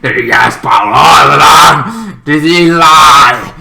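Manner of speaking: very high, loud shouted rant with a crying edge; furious outburst. Retold or imitated. Angry Boy yelling at Green Boy at the academy, furious. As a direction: scream it out in jealous rage. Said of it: "So Angry Boy is like".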